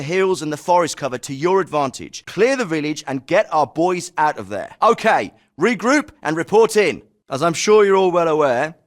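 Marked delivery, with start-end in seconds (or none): 4.8-7.0 raised commanding call; called-out order. Acted. Officer telling squads to regroup and report in, called-out order.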